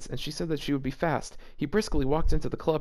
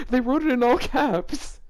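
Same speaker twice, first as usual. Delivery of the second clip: laughing; 8 semitones higher, 5 dB louder than usual. Real, not acted. Laughing while reading fanfic.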